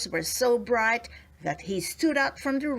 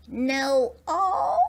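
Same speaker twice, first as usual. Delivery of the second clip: drawn-out higher fairy voice, a little louder; thoughtful; 4 semitones higher, 4 dB louder than usual. A narrator's reading. Fairy considers the gnome's odd name, drawn out and thoughtful. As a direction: muse it slowly like a fairy. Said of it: "said one of the fairies thoughtfully".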